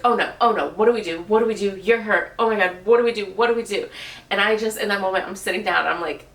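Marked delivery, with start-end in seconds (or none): 0.0-3.9 louder, retelling his frantic panic; retold freaking out. Partner panicking over her cut foot, imitated frantic fluster. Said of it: "But he's freaking out".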